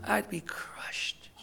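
slow, whispered heartbreak; crushed whisper. Acted. Preacher imagining his son homeless, whispered and crushed. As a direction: let it break into a whisper.

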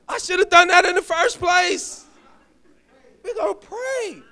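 high, loud, relieved laughing agreement. Retold or imitated. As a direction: snap out of panic with a laugh. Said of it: "I was like".

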